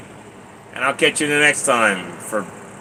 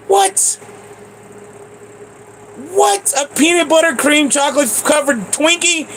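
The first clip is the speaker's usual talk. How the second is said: stunned disbelief. Real, not acted. Discovering peanut butter Twinkie.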